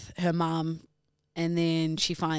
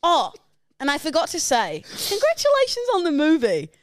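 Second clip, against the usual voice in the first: mock sweet afterthought; 10 semitones higher, 7 dB louder than usual. Fake afterthought before the dig.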